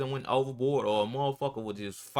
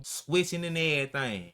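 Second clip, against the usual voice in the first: ordinary delivery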